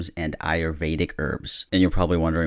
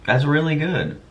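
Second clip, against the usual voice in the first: pleased taste reaction; 8 semitones higher, 3 dB louder than usual. Real, not acted. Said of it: "live taste test just to show you my reaction".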